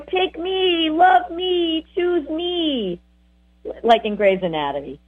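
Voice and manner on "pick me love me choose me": put-on pleading kids. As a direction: beg like a child wanting to be picked. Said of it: "like little kids going".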